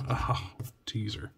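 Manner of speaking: disappointed breathy whisper under the breath; a hushed oh, teaser after a near miss. Real, not acted. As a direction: whisper it to yourself, letdown. Scratch reveal, disappointed aside.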